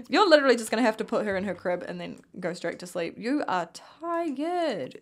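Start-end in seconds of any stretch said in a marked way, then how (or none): 3.2-5.0 slow drawn out. Real, not acted. Telling sim she's tired.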